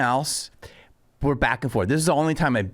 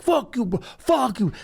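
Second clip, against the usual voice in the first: high-pitched imitation of an angry man; enraged heckler; 9 semitones higher than usual. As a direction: hurl curses as the mock heckler. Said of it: "And he's like".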